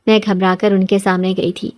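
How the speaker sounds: usual speaking voice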